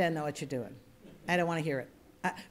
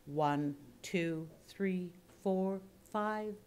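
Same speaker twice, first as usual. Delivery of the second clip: counting up the notes; 8 dB quieter, much slower than usual. Shown as a demonstration. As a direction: step the pitch up with each number. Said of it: "That's a good note to hit".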